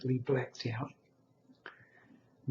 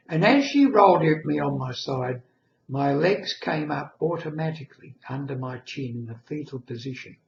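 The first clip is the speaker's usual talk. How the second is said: ordinary talk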